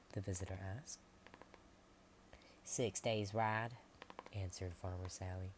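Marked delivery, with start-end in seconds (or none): 2.6-3.8 louder, very slow; grim answer. Acted. Farmer Sally telling the visitor how far the coast is. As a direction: spell out the bad news slowly.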